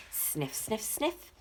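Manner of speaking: quick soft sniffs, rising; sniffing sounds. Acted. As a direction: sniff like a dog on a scent. Dog sniffing for clues.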